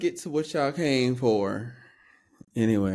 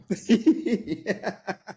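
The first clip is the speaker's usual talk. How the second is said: high bursting laugh; burst of laughter; 10 semitones higher than usual. Real, not acted. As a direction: crack up at the title. Laughs at post title.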